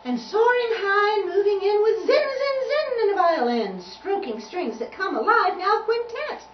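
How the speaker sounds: high, loud, soaring verse; excited, ringing picture-book recital; poem reading. A narrator's reading. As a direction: let the rhyme soar at full voice. Reads rhyming picture book.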